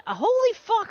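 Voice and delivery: high, startled outburst; shocked exclamation. Real, not acted. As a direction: blurt it in sudden shock. Sees coal burned.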